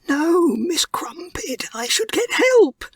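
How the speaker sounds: high, breathless, half-crying plea; a frightened mouse torn about leaving; panicked mouse. A narrator's reading.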